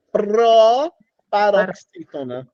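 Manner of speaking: "proparoxítona" very slow, with a long opening pro; "proparoxítona" announced slowly, pausing after pro; drawn-out term. Shown as a demonstration. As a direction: stretch "proparoxítona" out for the class. Teacher drawing out the new term.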